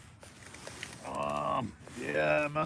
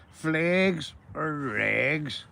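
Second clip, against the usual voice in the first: slow teasing drawl; 2 semitones higher, much slower than usual. Pretend. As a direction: stretch out "Flags or rags?" very slowly and playfully. Repeats the teasing question, drawn out.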